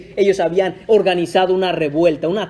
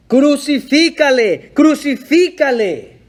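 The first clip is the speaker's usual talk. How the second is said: louder, higher, slow shouted chant; intense crowd shout; 6 semitones higher, 4 dB louder, slower than usual. Retold or imitated. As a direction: roar it like a mob demanding death. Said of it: "gritaban aún más ... con mayor intensidad". (grito intenso y lento de la multitud)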